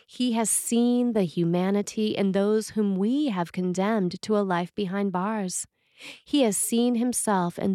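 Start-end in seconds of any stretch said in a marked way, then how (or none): none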